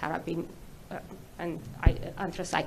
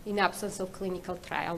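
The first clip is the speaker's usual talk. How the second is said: ordinary delivery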